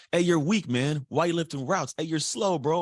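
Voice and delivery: high, jeering taunt; mocking teammates. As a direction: needle him like a teammate poking fun. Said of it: "people make fun of you".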